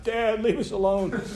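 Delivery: high, slow, sleepy whine at dad; whiny kid. Retold or imitated. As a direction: drag it out like a groggy child. Kids whining at dad at breakfast.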